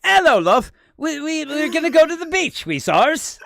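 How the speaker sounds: high, loud British mum voice; British accent. Retold or imitated. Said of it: "my mom has a British accent".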